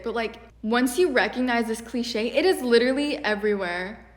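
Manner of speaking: normal talking voice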